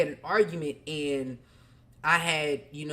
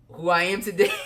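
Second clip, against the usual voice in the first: amused laugh, a bit louder; 4 semitones higher, 5 dB louder, faster than usual. Real, not acted. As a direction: laugh breaks through, pitched up. Laughing at his old self.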